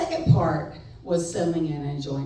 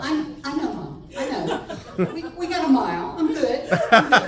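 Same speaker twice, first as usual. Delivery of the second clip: laughing protest; 7 semitones higher, 5 dB louder than usual. Retold or imitated. Acted quarrel with mom.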